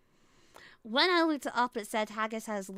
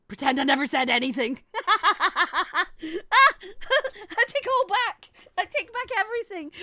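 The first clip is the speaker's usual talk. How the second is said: loud, higher, laughing take-back; laughing apology; 8 semitones higher, 9 dB louder than usual. Real, not acted. Streamer taking back a joke, laughing apology. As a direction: giggle through the apology, half sorry.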